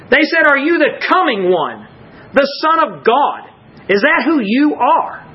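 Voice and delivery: high, insistent preaching voice; impassioned preaching. Real, not acted. Preacher contrasting the questions they did not ask, impassioned. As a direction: drive each question home from the pulpit, pitched high.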